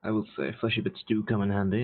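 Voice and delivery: normal talking voice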